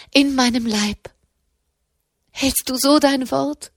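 high, insistent pleading; flehend. A narrator's reading. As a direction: implore God, holding him to his promise. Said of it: "flehte sie". (hohes, eindringliches Flehen)

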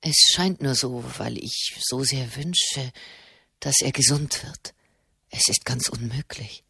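low, soft, faltering disbelief; distraught. Acted. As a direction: murmur it to yourself, shaken. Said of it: "dachte Marchesano verstört".

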